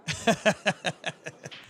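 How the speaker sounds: short staccato chuckle; laughing. Real, not acted. Commentator breaking off mid-sentence to chat, chuckling. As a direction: break off into a string of quick chuckles.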